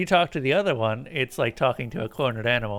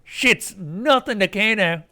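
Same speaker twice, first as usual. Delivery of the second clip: high rough street voice, loud; Kano's voice; 7 semitones higher, 4 dB louder, slower than usual. Acted. Player reading the game's Kano loudly. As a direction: play him cocky and loud.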